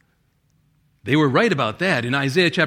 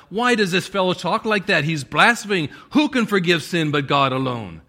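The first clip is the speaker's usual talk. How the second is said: loud, raised-pitch indignant questioning; outraged; 4 semitones higher, 5 dB louder than usual. Acted. Law teachers appalled at Jesus forgiving sin, outraged. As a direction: act out scandalized religious teachers, forcefully. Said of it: "They were outraged".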